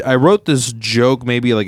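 ordinary talk, nothing marked

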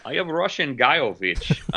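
ridiculous fake Russian accent; hammed-up Russian disguise voice; bad Russian accent. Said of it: "in a very, very ridiculous, bad Russian accent".

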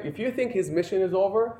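ordinary talk, nothing marked

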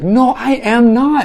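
high, slow, loud mock protest; indignant retold objection; disbelieving denial. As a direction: imitate her affronted refusal, drawn out. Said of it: "she couldn't believe I was saying that".

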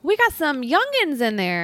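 high, squeaky little leprechaun voice; голос лепрекона. Pretend. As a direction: squeal it fast like a tiny leprechaun. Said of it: "I don't know why I said it like that".

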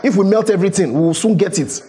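impassioned preaching. Acted. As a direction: drive the debt image home with fire. Preaching at full force.

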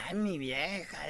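a light imitation of a husband teasing his wife; lightly voiced husband teasing his wife during yoga; Tommy Motola. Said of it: "Y dice".

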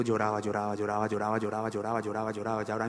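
low, soft run-on repetition; droning repetition. Retold or imitated. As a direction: repeat it in an unbroken murmur to show endlessness. Stretching out a child's endless crying.

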